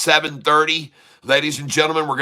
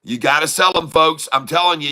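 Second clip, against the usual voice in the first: normal talking voice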